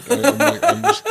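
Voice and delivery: high, breaking into laughter; laughing. Real, not acted. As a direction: laugh while trying not to. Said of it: "trying not to laugh".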